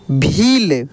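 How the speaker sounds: भील with the ī held long; drawn-out long ī. Shown as a demonstration. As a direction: stretch the ī of भील for learners. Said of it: "इसका उच्चारण खींच कर होता है". (भील की ई खींच कर)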